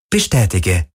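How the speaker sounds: lightly voiced computer acknowledgement; hint of an impersonal ship voice; androgynous ship's computer. A narrator's reading. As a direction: answer as an androgynous machine. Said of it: "antwortete die androgyne Stimme des Schiffs".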